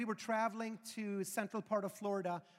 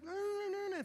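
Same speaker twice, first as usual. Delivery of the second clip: high-pitched mock toddler fussing; whining child; 10 semitones higher, 3 dB quieter than usual. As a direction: imitate a complaining little kid. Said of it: "kids that are whining and complaining".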